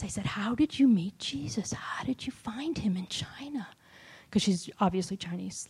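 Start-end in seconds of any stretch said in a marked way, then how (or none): 0.2-3.7 hushed amazement. Retold or imitated. Acts out amazed question to lady.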